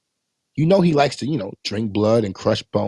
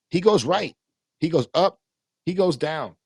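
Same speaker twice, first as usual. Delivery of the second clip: normal talking voice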